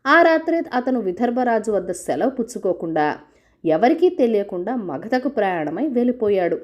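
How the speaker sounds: ordinary delivery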